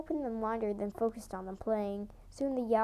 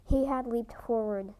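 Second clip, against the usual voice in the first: plain reading voice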